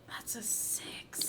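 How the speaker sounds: breathy whisper, no voice. Real, not acted. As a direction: whisper it to yourself. Quietly noting the die roll.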